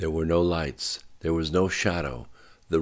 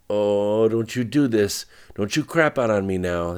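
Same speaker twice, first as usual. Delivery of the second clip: loud, higher-pitched frustration; raised voice, exasperated plea; desperate scolding; 5 semitones higher, 7 dB louder than usual. Acted. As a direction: berate the failing cart in desperation. Driver pleads with dying cart.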